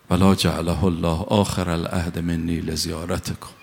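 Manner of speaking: ziyarat recitation. Acted. Preacher leads the congregation in salutation, solemn chant. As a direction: intone it like a prayer.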